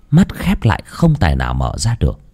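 ordinary delivery

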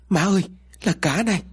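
high-pitched cry of alarm to mother; startled yell. A narrator's reading. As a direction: call out in shock, pitched well up. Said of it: "Tèo hét lên".

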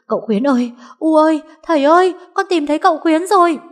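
high, loud overjoyed shout; joyful yelling. Acted. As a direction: scream the news to everyone with all her might. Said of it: "dùng hết sức mà thét gọi". (thét gọi hết sức, mừng rỡ)

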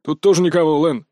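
cheerful guard reporting in; brisk report. A narrator's reading. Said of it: "бодро отрапортовал ещё и третий голос".

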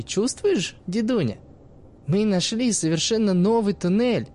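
put-on sly teenage girl talking to the old man; cheeky girl's voice. Narrator voices the girl Iskra.

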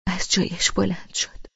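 plain reading voice

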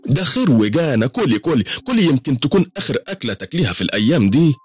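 high, insistent woman's voice urging food; high-pitched acted gobbler egging her friend on; greedy eater. A narrator's reading. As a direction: push the food on her eagerly, mid-feast. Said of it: "وهي بتحشر حمامة كاملة في بقها".

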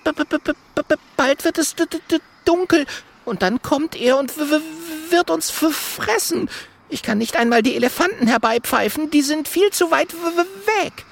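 very high, frightened stutter; high-pitched scared little monkey; whispering, trembling voice. A narrator's reading. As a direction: stammer in terror on the first sounds. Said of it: "Jojo flüstert mit zitternder Stimme".

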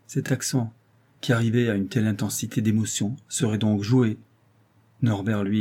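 plain reading voice

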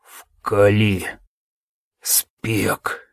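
low, faint dying words; strained weak voice. Acted. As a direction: barely get it out. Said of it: "с трудом давалось каждое слово".

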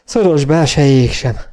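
high, halting account between breaths; winded, struggling through the story; panting. A narrator's reading. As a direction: gasp out the report in broken phrases. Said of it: "lihegte a karvajórú kóbor Jenny".

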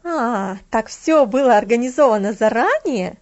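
loud exclamation; catching on, outraged; loud surprised outburst; indignant realization. A narrator's reading. Woman realising the plot.

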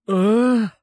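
high, loud questioning "huh"; a dazed, inarticulate "eh?"; dazed grunt. A narrator's reading. Vitya answers from the floor after a punch, dazed grunt. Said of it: "промычал он".

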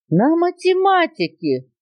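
high, drawn-out outraged echo; indignant. A narrator's reading. Parrot teacher shocked at boa's request, indignant. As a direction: throw the words back in high indignation. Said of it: "возмутился попугай".

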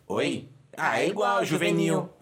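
ordinary talk, nothing marked

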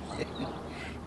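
breathy, quiet huff of a laugh; a short unvoiced chuckle; amused at the clockmaker story, a soft breathy laugh. Reacting to the clockmaker tale.

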